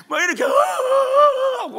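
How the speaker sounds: loud, high, drawn-out mock wail; demonstrated bawling. Shown as a demonstration. Preacher showing the congregation how he cried, exaggerated bawling. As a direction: show how you howled with crying. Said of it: "어떻게 울었냐?".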